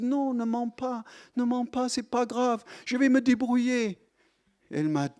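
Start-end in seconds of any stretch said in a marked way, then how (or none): 0.0-4.0 soothing aunt. Retold or imitated. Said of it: "j'ai dit".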